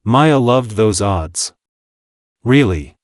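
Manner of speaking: plain reading voice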